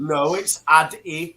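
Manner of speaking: "Adidas" as AD-ee-das, first syllable hit; corrective British pronunciation. Shown as a demonstration. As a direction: spell out "Adidas" by sound, AD-ee-das. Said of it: "say it properly".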